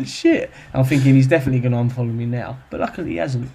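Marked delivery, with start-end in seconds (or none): 0.1-0.5 1 panicked curse. Retold or imitated. Blurting panic after the call.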